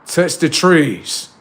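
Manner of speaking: loud, high, drawn-out order; slow, forceful shout; stern command. Acted. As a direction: boss them around, stretching the words. Strict self giving orders.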